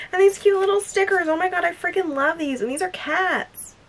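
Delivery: high-pitched cooing over something cute; real high-voiced glee; gushing delight. Real, not acted.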